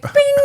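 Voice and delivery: very high, loud imitated ping; high-pitched bing. Speaker jokes here I am, high mimicked ping. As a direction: chirp a bright little alert sound.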